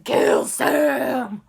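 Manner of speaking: loud, drawn-out mock-epic cry; dramatic shout. Real, not acted. Teller announcing Demon Dean's killing, shouted dramatically. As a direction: blast out the twist, stretching each word. Hammed-up shout of plot twist.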